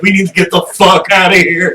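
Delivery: high, loud mock alarm; urgent acted cry. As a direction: play a comic urgent escape plea. Acts out panicking buddies' line.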